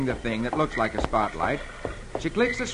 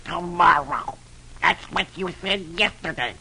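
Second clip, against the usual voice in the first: cross cartoon duck voice, restrained; understated anger in character; Donald Duck; 3 semitones higher than usual. A narrator's reading. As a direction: grumble back in a put-on duck voice. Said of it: "Donald says angrily".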